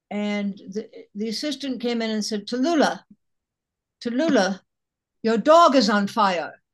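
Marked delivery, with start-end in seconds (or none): 2.5-6.6 alarmed call. Retold or imitated. Assistant alarm retold.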